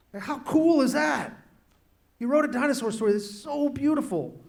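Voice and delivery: real thrill over a favourite story; excited. Real, not acted. Raving about the story.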